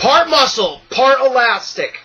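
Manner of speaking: loud, high announcer-like enthusiasm; over-enthusiastic tagline read. Shown as a demonstration. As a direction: belt out each phrase with gusto, high and loud. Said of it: "not gonna read it with as much enthusiasm now".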